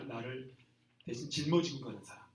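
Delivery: ordinary talk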